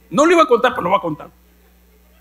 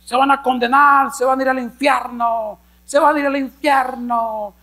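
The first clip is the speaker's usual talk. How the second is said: a lightly voiced megaphone street preacher; 7 semitones higher than usual. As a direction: warn of hell like a corner preacher. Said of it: "con el megáfono ahí hablando". (predicador callejero con megáfono, algo imitado)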